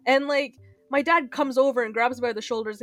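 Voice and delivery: ordinary talk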